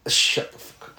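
hissed whisper. Real, not acted. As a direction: whisper it at a friend. Mock rebuke of friend's joke.

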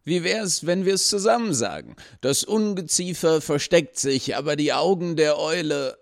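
high, wheedling suggestion; coaxing gatekeeper. A narrator's reading. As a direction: coax her into saying it together. (hoch und schmeichelnd)

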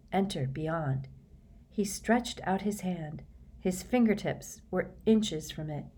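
ordinary delivery, nothing marked

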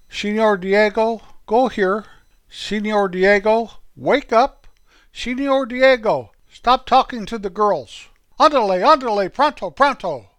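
high, loud teacher calling out orders; Mister Foster. A narrator's reading. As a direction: call out sharply to a pupil in class. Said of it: "he liked to call people by their Spanish name".

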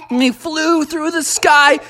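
ordinary delivery

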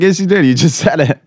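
teasing wife. Retold or imitated. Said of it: "She's like".

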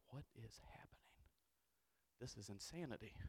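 hushed, stunned whisper; shocked whisper. Retold or imitated. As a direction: breathe it out in disbelief. Retold shock at the rabbits.

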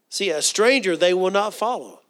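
ordinary talk, nothing marked

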